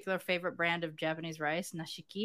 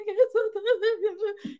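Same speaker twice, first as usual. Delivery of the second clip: giddy laugh; 4 dB louder than usual. Retold or imitated. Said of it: "And I was just like".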